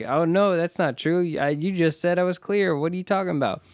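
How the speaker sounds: mock protest. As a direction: protest you were just cleared. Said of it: "he's like".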